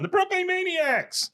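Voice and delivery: very high, drawn-out stubborn holdout; Peggy. Retold or imitated. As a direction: sing out your own version over the crowd, imitating. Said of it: "one voice you hear that's not saying that".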